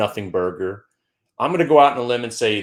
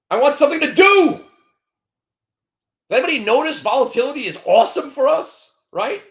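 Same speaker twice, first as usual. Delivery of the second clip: excited bear rant; 9 semitones higher, 7 dB louder, faster than usual. Real, not acted. Ranting trader, excited bear.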